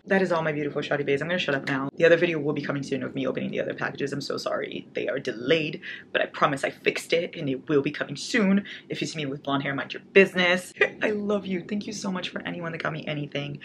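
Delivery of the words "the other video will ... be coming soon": usual speaking voice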